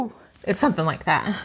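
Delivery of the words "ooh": high breathy whooping call; a high wild whoop through the woods; Bigfoot call. Shown as a demonstration. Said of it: "Would you like to demonstrate a Bigfoot call?".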